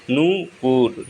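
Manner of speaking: नूपुर split in two, with the नू drawn out; long ऊ in नूपुर. Shown as a demonstration. Teacher showing students the long vowel, नूपुर said syllable by syllable. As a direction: stretch the first vowel of नूपुर, then say पुर. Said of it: "नू, न में बड़े ऊ की मात्रा".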